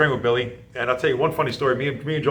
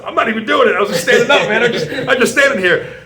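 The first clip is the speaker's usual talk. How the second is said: laughing protest; 12 semitones higher, 9 dB louder than usual. Retold or imitated.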